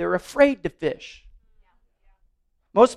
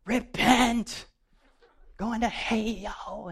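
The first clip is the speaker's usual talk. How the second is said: imitating street evangelist; 3 semitones higher, 8 dB quieter, much slower than usual. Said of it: "They're just giving everybody what for".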